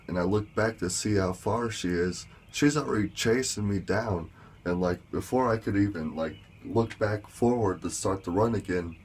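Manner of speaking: ordinary talk, nothing marked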